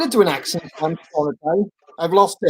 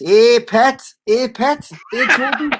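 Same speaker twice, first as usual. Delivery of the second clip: high-pitched mock Geordie greeting; Geordie accent; 5 semitones higher, slower than usual. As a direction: call out in a put-on Geordie lilt. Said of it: "Geordie, please".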